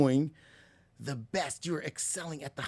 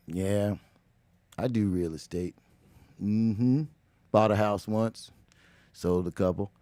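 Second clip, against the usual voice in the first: much lower, flat and listless, low energy; dull guest; 9 semitones lower than usual. Retold or imitated. Imitating a boring guest on Speedy's show, low and lifeless. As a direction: sound bored with your own job. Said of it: "been like".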